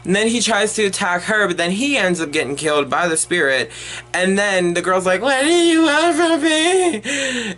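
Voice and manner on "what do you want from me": mock frightened plea. Girl's plea in retold climax.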